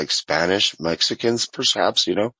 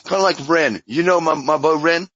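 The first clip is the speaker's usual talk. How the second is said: higher-pitched mock Cockney, sliding toward Australian; made-up Cockney; 7 semitones higher than usual. Shown as a demonstration. Telling a Brit about musician [name], higher made-up Cockney. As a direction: push a fake Cockney up high. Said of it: "It's just kinda made up a little bit".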